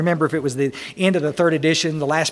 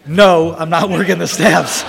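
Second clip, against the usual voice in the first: slow, louder, laughing admission; blunt translation; 6 dB louder, slower than usual. Retold or imitated. Speaker to the group, revealing what the excuse means, slow and laughing. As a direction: spell out the plain truth slowly. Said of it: "Which always translates to".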